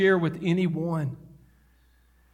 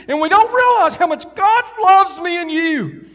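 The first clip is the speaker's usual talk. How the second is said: emotional preacher hollering to the church; preaching at full force; 4 semitones higher than usual. Real, not acted. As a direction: let the sermon carry you away. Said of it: "'cause I get to hollering sometimes and getting emotional".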